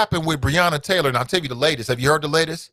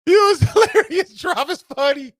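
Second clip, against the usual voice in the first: laughing. Real, not acted. Vlogger laughing at a viewer's joke, high and giggly. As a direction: giggle the words out.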